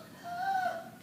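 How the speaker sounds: sudden frightened gasp in; sharp gasp. Acted. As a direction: gasp as if shocked underwater. Terrified by shark attack.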